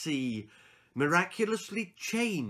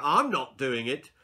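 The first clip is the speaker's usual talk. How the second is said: loud, higher firm scolding; exasperated teacher; 5 semitones higher, 6 dB louder than usual. Acted. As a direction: lay down the rule. Teacher argues with girl.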